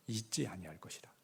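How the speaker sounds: hushed, breathy whisper of God's promise. Acted. Preacher quoting God's promise to the congregation, whispered.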